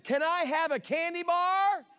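put-on high pleading kid voice; child's request. Kid begging a parent for a candy bar, high pleading voice.

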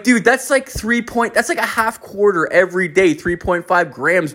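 high-pitched incredulous reckoning; retold disbelief. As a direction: work it out in mock amazement. Acting out disbelief at coworker's habit.

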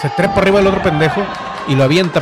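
rising, excited ringside call; wrestling commentator. Acted. Play-by-play of the fatal move.

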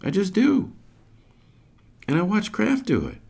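put-on high, breezy child voice; little girl answering. Sage answering her brother, high and breezy. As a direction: shrug it off. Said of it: "Sage answers".

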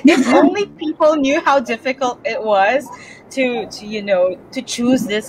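laughing while talking; rueful laugh through the words. Real, not acted. Laughing about her struggles.

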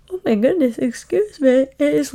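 words stretched by a real yawn. Real, not acted. Late at night, apologising to listeners mid-yawn. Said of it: "and I'm yawning".

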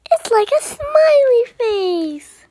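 high, drawn-out cheerful voice; cheerful unicorn. Acted. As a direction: explain slowly in a gleeful high pitch. Unicorn cheerfully explains happiness to dragon.

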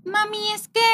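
put-on high, hushed, hesitant child voice; child stalling before she speaks; little daughter's voice. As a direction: play a shy little girl working up to it. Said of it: "Lore luego llega y así de".

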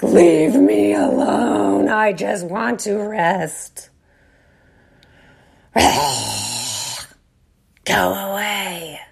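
slow, dragged-out go away; slow tired snarl, half pleading; weary growling plea. Retold or imitated. Rage of younger self voiced.

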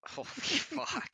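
high, slow, breaking into laughter; laughing swear; laughing disbelief. Real, not acted. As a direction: stretch it out while laughing at the dark joke. Stunned reaction to the joke.

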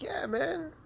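sarcastic high-pitched agreement; mock reluctant reply. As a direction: play along in a silly high voice. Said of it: "I'm just like".

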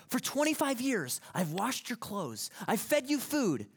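high, loud exasperated snap; snapping wife. Retold or imitated. Goldie snapping at Tevye over years of chores, loud exasperated outburst. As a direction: fire back every chore like a wife fed up. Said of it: "she kind of snaps".

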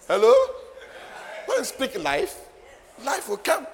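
high, punchy pulpit declaration; rousing preaching. Real, not acted. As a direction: proclaim it to stir the congregation. Preaches declaration at full force.

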